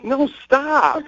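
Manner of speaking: high-pitched girlfriend impression; imitating needy girlfriend. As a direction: mimic her pleading objection, pitch way up. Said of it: "but the way she says it".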